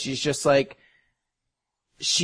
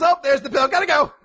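high, louder mock voice, hurried excuse; guy dodging the bill; 12 semitones higher, 4 dB louder than usual. Joking about a guy fleeing the check, high and louder. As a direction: rattle it off like a guy ducking out of paying. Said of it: "Webster's dictionary would define the word like as".

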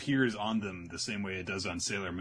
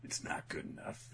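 soft, breathy dismissive verdict; cold whisper; 9 dB quieter than usual. Retold or imitated. Shinomiya dismisses the dish, a cold whisper. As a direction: drop it to a whispered put-down.

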